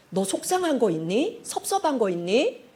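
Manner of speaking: soft, tender inquiry; quiet, caring question; gentle concern. Shown as a demonstration. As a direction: coax them gently to open up.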